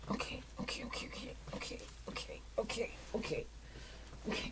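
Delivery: whisper. Real, not acted. Whispering to herself.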